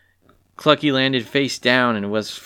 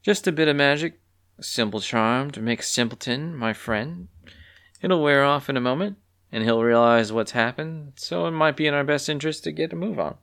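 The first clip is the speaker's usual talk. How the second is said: coy explanation, pitched a little up; playful coyness; 6 semitones higher than usual. A narrator's reading. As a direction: explain it lightly and coyly. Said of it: "answered the other Pollen coyly".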